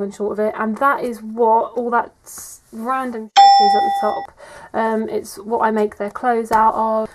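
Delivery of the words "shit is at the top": squeaky pitch jump. Real, not acted.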